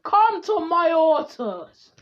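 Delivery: booming summons, high and stretched out; calling out. Acted. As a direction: summon every universe with a slow, full shout. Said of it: "he calls out".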